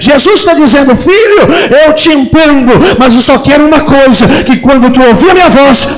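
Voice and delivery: loud, driving Pentecostal proclamation; pregação a plena força. Real, not acted. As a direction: declare each blessing forcefully in rhythmic phrases. Preaches at full force. (pregação pentecostal inflamada e profética)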